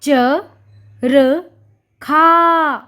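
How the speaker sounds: च, र, then long खा; syllable by syllable. Shown as a demonstration. Teacher sounding out चरखा. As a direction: split चरखा into च, र, खा. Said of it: "चरखा कैसे लिखते हैं?".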